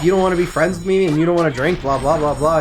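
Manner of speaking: accusing woman. Acted. Woman character accuses him.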